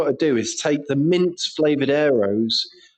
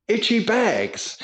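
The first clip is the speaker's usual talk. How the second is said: slow, slightly higher, stretched words; drawn-out catchphrase; 5 semitones higher, slower than usual. Retold or imitated. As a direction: linger on the silly phrase. Introduces the odd catchphrase.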